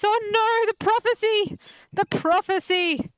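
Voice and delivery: high, loud hammed-up alarm; mock dramatic cry. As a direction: wail it like a melodrama, slowing at the end. Mock dramatic cry over game.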